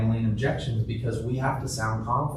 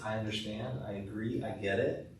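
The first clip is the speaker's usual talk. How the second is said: soft, gentle agreement; quiet reassurance to an objection; empathetic; 7 dB quieter than usual. Shown as a demonstration. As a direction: consultant soothes a hesitant patient, softly. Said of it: "your tone of voice. It sounds more empathetic".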